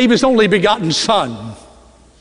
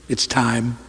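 soft inner thought; 8 semitones lower, 6 dB quieter than usual. Retold or imitated. Inner thought, quoted softly.

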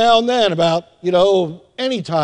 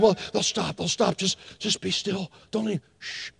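put-on calm the spouse without waking the baby; soft whispered shushing; hushed reassurance; 11 dB quieter than usual. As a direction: whisper it, much softer. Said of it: "He said".

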